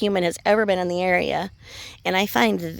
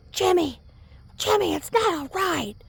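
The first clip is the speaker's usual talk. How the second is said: mild mock scream to her husband; mock screaming wife; 11 semitones higher than usual. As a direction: imitate a wife's panicked cry, pitched up. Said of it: "she was just screaming".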